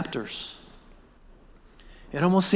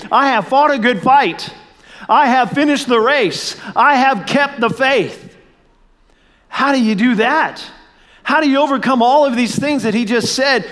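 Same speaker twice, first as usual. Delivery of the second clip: rising preaching; 4 semitones higher than usual. Acted. Pastor stirring the congregation with rising fervor. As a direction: preach it to the crowd with mounting fervor.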